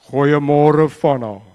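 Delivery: loud, booming divine greeting; God's thundering voice calling a name. Retold or imitated. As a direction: boom it out like God calling from above. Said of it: "like, speaks to".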